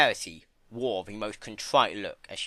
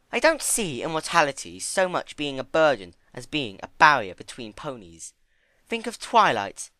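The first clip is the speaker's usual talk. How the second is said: higher, louder, grave reflection; sober Rarity; 5 semitones higher, 5 dB louder than usual. Acted. Rarity explaining to Fluttershy about Twilight, grave and higher. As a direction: weigh each word.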